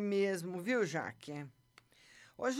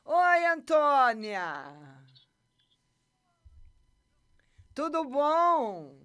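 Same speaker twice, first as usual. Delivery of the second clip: loud, drawn-out greeting; calling out; 6 dB louder, much slower than usual. Real, not acted. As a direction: stretch the words, call across a room. Greeting a caller on live.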